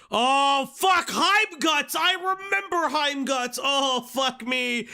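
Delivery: high, loud sudden recognition shout; excited shout. Real, not acted. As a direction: yell it as it comes back. Sudden memory of a name.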